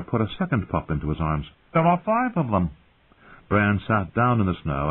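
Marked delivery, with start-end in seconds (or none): 1.7-2.7 Jon's voice. Acted. Jon voiced, boy.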